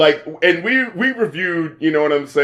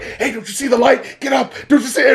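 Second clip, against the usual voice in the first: higher, mock insistent pleading; imitated urgent rousing; 6 semitones higher than usual. Voices trying to wake her up, imitated urgency. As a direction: urge a sleeper awake, pitched up. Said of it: "starts getting darker and darker".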